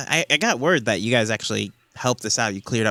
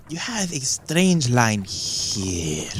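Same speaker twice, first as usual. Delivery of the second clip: very slow, ominous reading; slow mysterious seer voice; mystic palm reader; much slower than usual. Acted. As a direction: drag each word like a fortune teller. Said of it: "She says".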